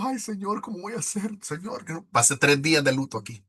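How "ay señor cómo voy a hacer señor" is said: higher, softer mock lament, fading out; imitating crying. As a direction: plead with the Lord as if weeping, trailing off. (lamento imitado, cada vez más suave)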